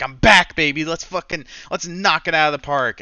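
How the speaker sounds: loud, cocky hype; triumphant comeback. Retold or imitated. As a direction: pump yourself up for the comeback. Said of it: "wanted to come back so badly and be like".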